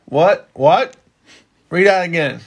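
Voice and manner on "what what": louder, higher, sharply rising questions; mock-incredulous double take; feigned disbelief. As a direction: play astonished at what was just read out. Feigns shock at the verse read.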